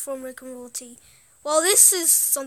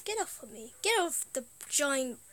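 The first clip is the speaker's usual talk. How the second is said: ordinary delivery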